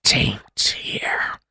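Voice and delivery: slow, hollow whisper from beyond the grave; ghostly whisper. Acted. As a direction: breathe it out like a trapped spirit. GM voices the spirit.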